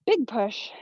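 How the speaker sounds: normal talking voice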